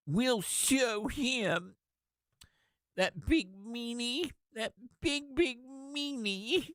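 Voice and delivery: put-on high, slow childish whining voice; mock them like whiny little kids; mock childish whine. Mocking senators as whiny children.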